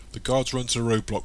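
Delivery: plain reading voice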